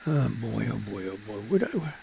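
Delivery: ordinary talk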